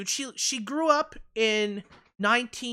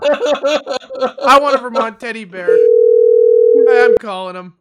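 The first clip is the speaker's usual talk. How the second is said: excited; 3 semitones higher, 14 dB louder, slower than usual. Real, not acted. Excited call to order bear.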